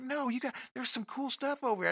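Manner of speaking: high, excitable buddy voice; a lightly high-pitched hyped-up buddy; mock friend voice. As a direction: squeak it like an eager friend.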